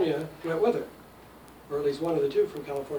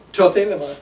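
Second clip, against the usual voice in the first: "to thelema" as one word, strong and louder on "to the"; accented together; 9 dB louder than usual. Shown as a demonstration. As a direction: join "to" to a stressed "the" in "thelema". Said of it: "to and the are part of the same word".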